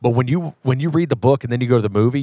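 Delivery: normal talking voice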